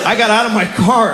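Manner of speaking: normal talking voice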